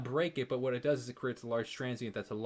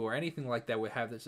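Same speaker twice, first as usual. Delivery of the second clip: normal talking voice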